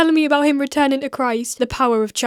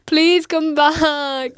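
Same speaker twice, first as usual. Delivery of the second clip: high, drawn-out hammed-up plea; begging manager; 4 semitones higher, 4 dB louder, much slower than usual. Old manager on his knees to ex-employee, mock begging. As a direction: plead desperately, stretching the words. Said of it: "got on his knees like this".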